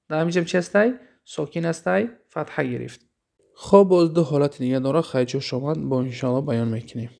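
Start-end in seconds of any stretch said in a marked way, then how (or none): none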